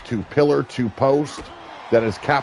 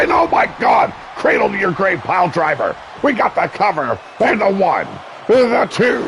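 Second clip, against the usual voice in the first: high, loud, climbing count call; excited shout; 6 semitones higher, 3 dB louder than usual. Real, not acted. Commentator calling a pile driver pin, excited shout. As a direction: build up through the count.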